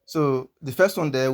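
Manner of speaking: normal talking voice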